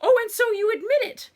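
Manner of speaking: high, loud horrified cry; horrified mother at the ruined plate. A narrator's reading. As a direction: gasp it out in horror. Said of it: "came the horrified reply".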